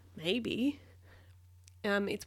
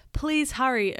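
high, raised, pressing voice; louder anxious appeal; urgent plea; 5 semitones higher, 6 dB louder, slower than usual. A narrator's reading. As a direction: urge them to get moving. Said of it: "urged Shellington".

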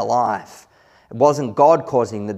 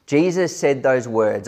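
ordinary talk

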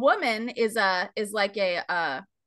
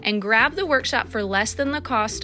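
upbeat sponsor read over music; slower than usual. Acted. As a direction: sell it like a friendly host.

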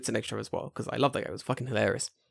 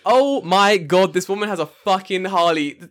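drawn-out, raised, louder amazement; awestruck; 3 semitones higher, 6 dB louder than usual. Retold or imitated. Him discovering the teacher's Harley, awestruck. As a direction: stretch out the mock awe.

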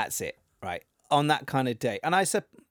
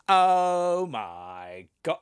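very slow, stretched-out amazement; mock awe; much slower than usual. Imagining the panel at her big reveal, mock awe. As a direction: draw every word out as if blown away.

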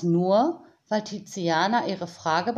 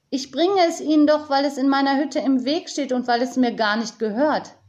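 put-on high, raised girl's voice; agitated protest; 6 semitones higher, 5 dB louder, faster than usual. A narrator's reading. Tiziana objects to keeping the bike, crying out. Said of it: "schrie Tiziana auf".

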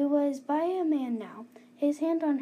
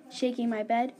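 ordinary delivery

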